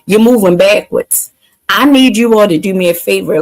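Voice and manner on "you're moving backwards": loud, high-energy stream opener; hype greeting. Real, not acted. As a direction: shout it out to the chat with energy. Livestream hype opening greeting.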